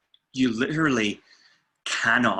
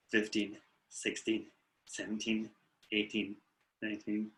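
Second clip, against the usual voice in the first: quiet, slow counting under the breath; hushed relaxation count; soft guided count; 8 dB quieter than usual. Shown as a demonstration. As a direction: count them through the tension gently.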